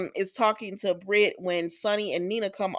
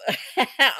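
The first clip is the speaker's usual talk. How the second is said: short real laugh, higher in pitch; amused laugh; 6 semitones higher, 4 dB louder than usual. Real, not acted. Recapper amused that the couple split, laughing. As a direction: let out a quick laugh.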